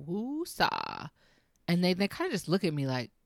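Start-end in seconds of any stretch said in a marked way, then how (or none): 0.1-1.1 put-on woosah said flatly straight at the teenager; a calm, knowing woosah, ending on a fall; woosah to a teen. Mom answering a teen's attitude with a woosah. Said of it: "I go".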